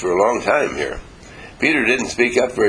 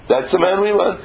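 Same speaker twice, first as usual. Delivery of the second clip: higher-pitched, eager cheering on; excited, urgent endorsement in a raised pitch; acclaiming crowd; 9 semitones higher than usual. Acted. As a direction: act out a crowd hailing its man. Acting out an enthusiastic crowd.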